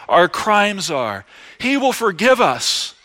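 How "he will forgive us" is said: ordinary talk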